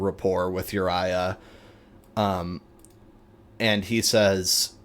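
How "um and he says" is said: ordinary talk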